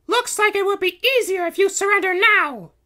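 very high, loud heroine voice; commanding princess. A narrator's reading. Princess Kitty telling the Baron to surrender, commanding. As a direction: demand surrender as a cartoon princess.

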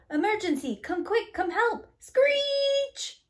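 high, loud panicked calling; rising alarm ending in a screech; urgent cry for help. Acted. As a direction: shout for help as the trucks slide.

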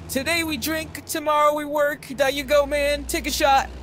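a loose, happy-go-lucky drinker offering a shot; Ricky's voice. A narrator's reading. Ricky's voice in the story.

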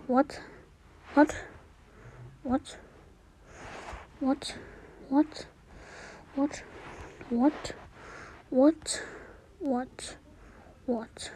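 very soft, dazed repetition; stunned muttering. Real, not acted. Player at a loss over the game, muttering very quietly. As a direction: barely voice each bewildered question.

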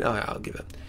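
normal talking voice

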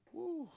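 loud triumphant whoop. Real, not acted. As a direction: let out a big gym whoop. Gym shout after a heavy set.

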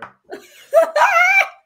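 loud, high burst of laughter; breaking into laughter. Real, not acted. The game master cracking up at his own duck accent, a loud high laugh. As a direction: crack up mid-line. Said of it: "I'm laughing at my own accent".

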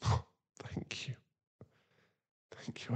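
soft, breathy gratitude; whispered thanks. Acted. To his partner agreeing to come, whispering thanks. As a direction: let the thanks fall out in a hush.

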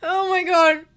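choking up as the win sinks in; crying with joy. Real, not acted. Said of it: "I'm literally crying".

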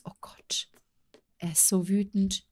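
tonlos gehauchtes Entsetzen; geflüstert. Real, not acted. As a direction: hauch es erschrocken vor dich hin. Entsetzt über den wütenden Ex-Mann. (erschrocken geflüstertes Oh Gott)

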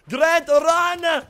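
high, very loud screaming warning; panicked shout. Real, not acted. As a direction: yell for him to run, panicking. Teammate chased in game.

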